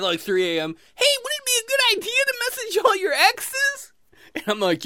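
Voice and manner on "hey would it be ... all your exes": very high, eager squeak; squeaky high-pitched cartoon eagerness; friendly inside elf. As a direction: pitch a bad idea in an innocent elf voice. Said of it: "Friendly the Inside Elf".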